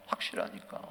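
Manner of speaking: low, soft 확실하니까; quiet, deep repeat of conviction; hushed conviction. Real, not acted. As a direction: drop 확실하니까 nearly to a whisper. Same word loud, then hushed.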